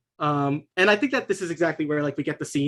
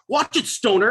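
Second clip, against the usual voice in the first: higher-pitched bully warning; aggressive warning; 7 semitones higher, 4 dB louder than usual. Acted. Kyle pushing the stoner aside, aggressive. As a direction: shove them with your voice.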